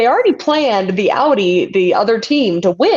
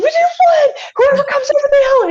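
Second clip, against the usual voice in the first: excited. Retold or imitated. Retelling victory on the radio.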